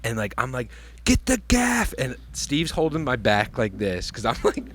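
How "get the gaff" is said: high-pitched mock urgent call. Retells calling out during the catch.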